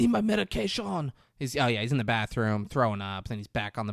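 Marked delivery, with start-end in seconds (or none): 0.0-1.1 high, put-on urgent cry; hammed-up plea; mock desperate demand. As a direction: imitate a frantic sick man. Acting out man needing medication.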